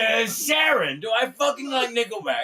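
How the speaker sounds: high, loud, befuddled rocker impression. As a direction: holler to the wife like a confused British rock star. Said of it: "if he ever actually met [name]".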